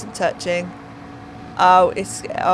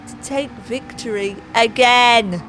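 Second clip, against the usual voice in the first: race commentary; 2 semitones higher, 4 dB louder, slower than usual. Acted. Commentator calling the final corner and results, rousing race commentary. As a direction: drag out the placings like a race announcer.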